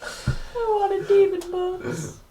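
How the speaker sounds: high, soft, slow pout; mock whimpering. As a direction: sulk in a small, whiny voice. Sulking in character over missed books.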